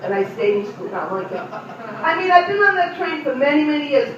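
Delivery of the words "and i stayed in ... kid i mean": ordinary talk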